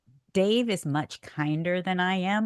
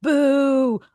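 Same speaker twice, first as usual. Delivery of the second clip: loud, higher, drawn-out boos; booing; 8 semitones higher, 7 dB louder, slower than usual. Retold or imitated. Husband hearing his wife as a crowd, booing. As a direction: boo like a hostile crowd. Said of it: "all I hear now is just".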